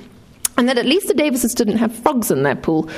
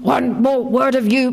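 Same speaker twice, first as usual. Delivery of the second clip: slow, mild warning from a mother; lightly voiced stern mother; warned; 3 semitones higher than usual. A narrator's reading. Mum warns her complaining daughter, slow and measured. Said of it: "Mum warned".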